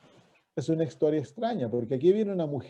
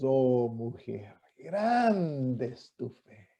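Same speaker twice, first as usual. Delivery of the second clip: soft, very slow, drawn-out praise; tender admiration; 4 semitones lower, 5 dB quieter, much slower than usual. Retold or imitated. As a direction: marvel gently, stretching each word. Voicing Jesus moved by faith.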